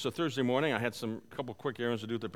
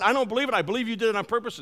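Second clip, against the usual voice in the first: higher, louder, rushing retold accusation; angry yelling; 7 semitones higher, 5 dB louder, faster than usual. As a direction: imitate an angry lady storming on. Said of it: "just yelling at me".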